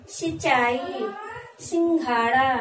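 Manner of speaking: सिंचाई and सिंघाड़ा said slowly and loudly with the nasal held through the nose; teacher sounding out nasal words; slow nasal demo. Shown as a demonstration. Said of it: "इसका जब प्रयोग करेंगे जैसे".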